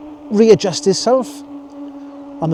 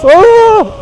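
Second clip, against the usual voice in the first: startled; 13 dB louder than usual. Real, not acted. Startled by car.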